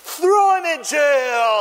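high, loud, drawn-out shout; hammed-up villain's decree; villain's order. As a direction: bellow the sentence slowly, falling. Villain condemns his debtor.

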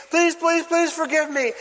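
high, insistent theatrical plea; mock begging. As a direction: beg for mercy on your knees. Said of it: "He drops to his knees".

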